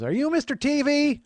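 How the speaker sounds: very high, loud neighbor lady voice; neighbor woman. Retold or imitated. Woman next door recognizing a TV guest, high loud character voice. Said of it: "a woman next door just goes".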